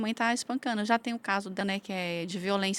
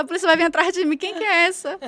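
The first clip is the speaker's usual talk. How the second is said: high and loud, laughing through the words; laughing while retelling a scare, higher and louder; laughing mock alarm; 8 semitones higher, 10 dB louder than usual. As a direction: play your own panic for a laugh, higher and louder.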